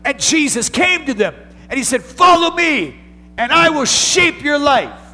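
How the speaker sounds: high, loud preacher's cry; preaching at full force. Acted. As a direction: ring it out to the back rows. Preaching peak.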